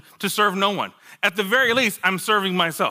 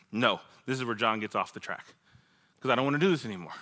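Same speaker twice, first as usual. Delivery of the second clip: low, soft, resigned opting out; bow out under your breath, retelling it; quiet refusal; 6 semitones lower, 7 dB quieter, faster than usual. Retold or imitated. Retold private refusal of the track.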